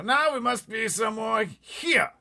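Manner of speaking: high, loud, slow wail; pompous officer aghast; panicky Air Force chief. Acted. Air Force head crying out to the pilot in alarm.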